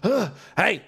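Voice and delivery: high, wild gun-waving threat; threatening drunk. Acted. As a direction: rant like a crazed gunman. GM voicing threatening NPC.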